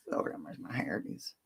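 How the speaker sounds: whispered to herself; hushed aside about her wild hair; low private whisper. Real, not acted.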